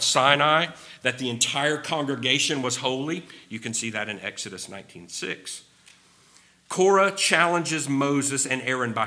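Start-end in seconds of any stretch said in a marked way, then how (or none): none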